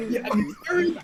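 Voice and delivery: high apology through laughter; laughing. Real, not acted. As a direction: apologize while still laughing. Still laughing after laugh.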